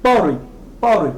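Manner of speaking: "Party" as "PAW-ree", with a tapped T; American pronunciation. Shown as a demonstration. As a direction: say "Party" like "pori", no hard T. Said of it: "les Américains prononcent party".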